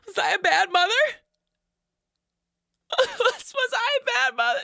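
a distraught mom asking if she failed; anguished mother. Acted. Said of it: "worrying my parents".

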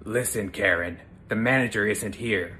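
dad's voice. Acted. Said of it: "How would your dad say it?".

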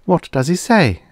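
high-pitched voiced anxiety, no real whisper; anxious whisper. A narrator's reading. Karen asking what he says, mildly anxious. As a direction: ask it high, with a touch of worry. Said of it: "whispered Karen anxiously".

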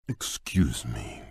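slow, shy whisper. Acted. Beast shyly asking a guest, whispered. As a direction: ask timidly under the breath.

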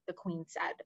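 ordinary delivery

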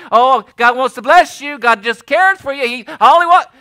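high, loud sing-song mock sweetness; mocking lovey-dovey. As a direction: parody the feel-good preachers. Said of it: "lovey-dovey stuff".

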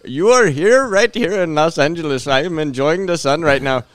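loud, high mock Indian agent; Indian accent. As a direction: put on a hammed-up Indian accent. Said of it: "your Indian voice is awesome".

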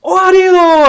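very high, loud drive-by yell; shouted taunt. Retold or imitated. Retelling a passing driver taunting soldiers in the Rover, high loud shout. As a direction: bellow it from a speeding car. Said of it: "he shouted".

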